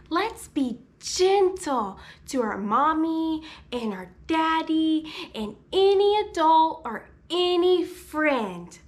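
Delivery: normal talking voice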